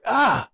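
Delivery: high yelp thrown back like an echo; be the echo of his cry. Retold or imitated. Echo answers the child, a high yelp. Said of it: "heard a voice come back".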